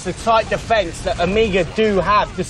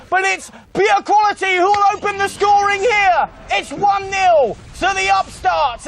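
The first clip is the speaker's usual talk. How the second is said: excited play-by-play; 10 semitones higher than usual. Acted. Excited goal call.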